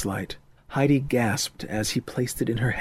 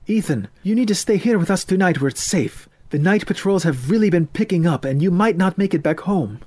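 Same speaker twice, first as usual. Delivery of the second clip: reassuring mother; 7 semitones higher, 5 dB louder than usual. Acted. Voices Missus Solomon.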